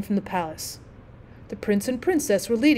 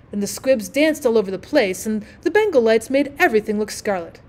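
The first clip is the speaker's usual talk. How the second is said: usual speaking voice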